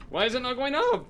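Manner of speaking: high, loud exasperated cry; exasperated yell. Real, not acted. Streamer yelling at the broken cannon, exasperated. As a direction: yell it at the screen in disbelief. Same question repeated, louder.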